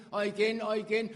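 loud repeated cry, climbing; building preaching cry. Acted. Preacher driving the refrain, rising loud cry.

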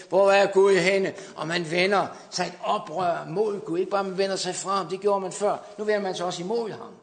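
loud, rising sermon voice; fiery revival preacher; preaching at full force. Acted. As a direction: preach it out to the hall, building.

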